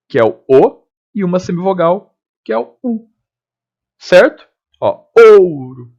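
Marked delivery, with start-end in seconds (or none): 5.2-5.9 "ouro" loud and slow, long O gliding into U; stretched "ÔU-ro", stress on the O; falling diphthong. Shown as a demonstration. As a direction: lean on the O of "ouro". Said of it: "Eu não falo ouro".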